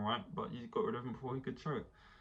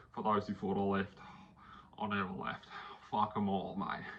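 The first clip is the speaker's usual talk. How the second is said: lightly imitated film-hero swagger; Daniel Ricciardo. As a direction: deliver it in dramatic, separated lines. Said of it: "he knows how to live up to the drama".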